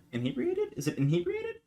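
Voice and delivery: very high, soft questioning; doubtful aside. Real, not acted. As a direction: try the word softly in a tiny high voice. Tries the word aside, doubtful.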